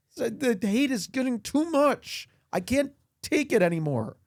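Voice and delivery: high, pleading mock distress; hammed-up hurt. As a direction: plead your innocence with mock tears. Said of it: "sorry to get emotional".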